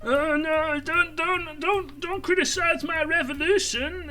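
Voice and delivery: high, whining parody of a squirming activist; mock whiny leftist. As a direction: whine it like a crybaby protester. Mocking Marxists who squirm.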